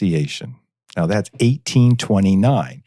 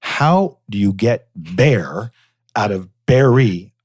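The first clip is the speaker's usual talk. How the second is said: ordinary talk, nothing marked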